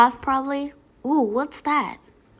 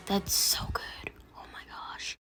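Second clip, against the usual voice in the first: whispering; 3 semitones lower, 14 dB quieter than usual. Real, not acted. Tasting food, whispered reaction.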